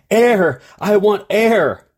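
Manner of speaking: mildly desperate acted cry; urgent demand. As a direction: beg for air, slowing on the last words. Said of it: "Without any hesitation".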